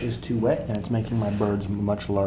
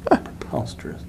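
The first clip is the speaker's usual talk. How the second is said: mock-dramatic whisper.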